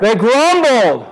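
high, slow, drawn-out call; real loud. Shown as a demonstration. Preacher leading the congregation in the phrase, real loud. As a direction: belt it out slowly for everyone to copy. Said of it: "Can everybody say real loud".

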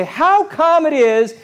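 high, loud, indignant crowd complaint; upset people. Retold or imitated. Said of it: "the Hebrew people ... they say".

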